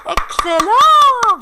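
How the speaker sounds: high, loud, drawn-out cheer; excited praise. Pretend. Teacher praising pupils' catch, excited. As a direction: sing out the praise, stretched.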